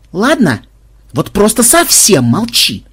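high-pitched, drawn-out demand for silence; exasperated insistence. A narrator's reading. Newcomer begs Мелисса for total silence, exasperated. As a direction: insist slowly, out of patience.

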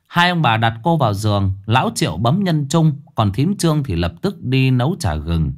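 ordinary delivery, nothing marked

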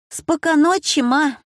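высоко и громко, на бегу; крикни маме «спока ночи»; звонкое пожелание. A narrator's reading. Дочь кричит перед сном. (дочь звонко кричит маме спокойной ночи)

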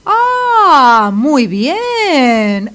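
long, swooping sarcastic exclamation; indignación sarcástica. Acted. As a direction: draw it out in mock approval, pitch soaring. Indignada porque el juez no interviene. (con indignación sarcástica, alargando las palabras)

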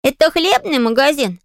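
put-on high-pitched child's question; little boy. A narrator's reading. Small boy asking Polly about the shop, high child voice. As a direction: pipe it up like a curious small child. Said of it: "спросил малыш".

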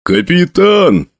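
high, drawn-out call to the captain; urgent call. A narrator's reading. Soldier waking his captain, calling urgently. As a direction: rouse a sleeper urgently.

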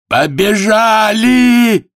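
high, loud, long-drawn cry; desperate shout. Acted. Voice from the carousel as the race starts, desperate shout. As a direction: scream it out and hold it. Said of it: "Отчаянный голос".

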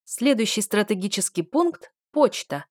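plain reading voice

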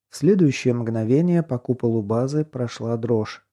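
plain reading voice

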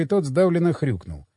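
ordinary delivery

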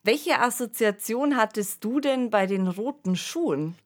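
ordinary talk

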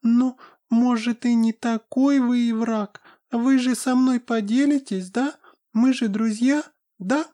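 high, sweet wheedling fairy voice; pixie. Acted. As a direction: coax like a greedy little fairy.

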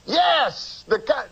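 Cosby-like agent voice. Retold or imitated. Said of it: "you start to talk like him".

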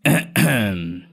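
short, deliberate ahem; throat clearing. A narrator's reading. Master clears his throat before pronouncing, deliberate ahem. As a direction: clear your throat importantly before speaking. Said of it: "thầy mới đằng hắng".

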